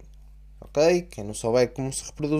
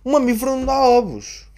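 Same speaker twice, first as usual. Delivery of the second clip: 1 indignant outburst; 10 semitones higher, 7 dB louder than usual. Real, not acted. Indignant rant about egg-laying rabbit.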